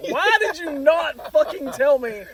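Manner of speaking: loud, high indignant demand; retold outrage. Replaying the argument with his girlfriend, loud outrage. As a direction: raise your voice, acting out outrage.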